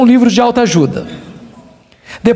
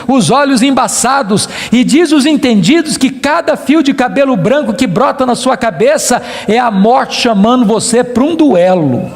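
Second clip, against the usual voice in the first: ordinary talk, nothing marked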